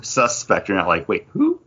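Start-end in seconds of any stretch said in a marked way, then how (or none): 1.1-1.6 sudden high confused question; a reader lost among suspects; confused wait who. Retold or imitated. Reader lost among the suspects, high and confused.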